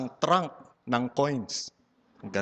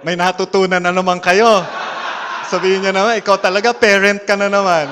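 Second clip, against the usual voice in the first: loud playful scolding, much louder; mock scolding; 5 semitones higher, 11 dB louder than usual. Speaker teasing audience about learning slang, loud mock scolding. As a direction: tease the crowd like a mock-stern preacher.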